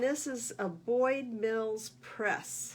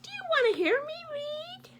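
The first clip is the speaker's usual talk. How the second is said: very high, fast whispered goose plea; eager whisper; 5 dB louder, faster than usual. Acted. Goose coaxing bear, high fast whisper. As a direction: breathe it out high and quick like a secret.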